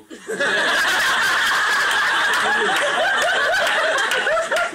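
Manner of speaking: high, loud cracking-up laugh; can't hold in the laugh; long burst of laughter. Real, not acted. Laughing at his own joke to the church guests.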